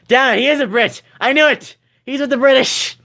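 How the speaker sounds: high-pitched playful indignation; mock outrage. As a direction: cry betrayal, hammed-up. Mock outrage at supposed British traitor.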